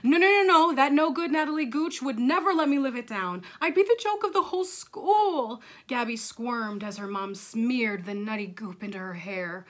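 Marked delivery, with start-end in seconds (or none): 0.0-5.6 put-on loud, high frantic kid; dramatic schoolgirl alarm; panicked protest. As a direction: refuse in horror at the humiliation. Gabby protests scissors in dread.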